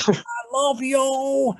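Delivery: mock scream. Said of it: "He screams".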